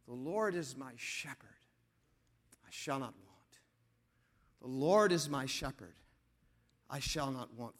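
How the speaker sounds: low, soft, reflective recitation; quiet low scripture, pausing between lines; meditative verse. Acted. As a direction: let the verse settle gently over the listeners. Lets verse flow over congregation, hushed.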